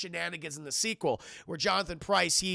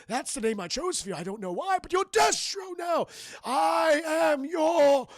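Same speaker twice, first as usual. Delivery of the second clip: slow, high, loud theatrical villain proclamation; drawn-out shouted villain voice; hammy villain; 7 semitones higher, 3 dB louder, slower than usual. Retold or imitated. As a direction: proclaim it like a cartoon overlord. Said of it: "And he goes".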